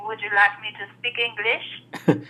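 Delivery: put-on French Canadian English; playful French Canadian imitation; French Canadian accent. Shown as a demonstration. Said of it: "only accent I can do".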